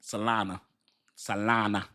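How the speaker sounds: "Solana" ending in an open "ah"; soft, deliberate "Solana"; correct pronunciation. Shown as a demonstration. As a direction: say "Solana" carefully with a final a. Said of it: "I don't say Solano".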